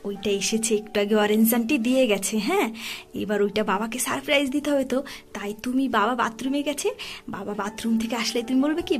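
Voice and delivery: soft conspiratorial whisper, barely voiced; hushed whisper. Real, not acted. Mother whispering the surprise plan to her son, hushed whisper. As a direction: whisper the secret plan so dad can't hear.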